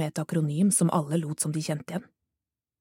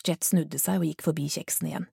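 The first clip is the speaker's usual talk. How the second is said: ordinary delivery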